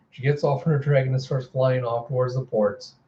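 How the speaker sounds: usual speaking voice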